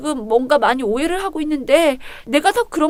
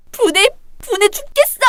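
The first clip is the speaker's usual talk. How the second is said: higher, strained complaint; seething frustration; 8 semitones higher than usual. Acted. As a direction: choke on the anger. Alone, seething.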